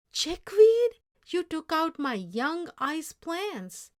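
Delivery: loud, high outraged shout on Chickweed; furious disbelief. Acted. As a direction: rage at the ruined planting. Ben furious about chickweed.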